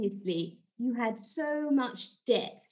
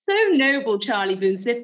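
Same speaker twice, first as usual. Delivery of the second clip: mock fawning; 9 dB louder than usual. Host flatters Charlie Boomsmith about the soup kitchen, mock fawning. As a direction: lay the flattery on thick. Host fawns over guest.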